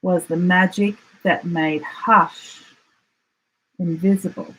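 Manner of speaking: plain reading voice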